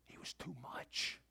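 slow, low near-whisper; hushed emphasis. Real, not acted. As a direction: let it land softly and slowly. Hushed answer to his refrain.

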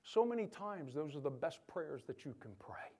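soft, breathy close to the point; whispered. Real, not acted. Preacher hushing the church on simple prayer, whispering. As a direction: drop to a whisper to land it.

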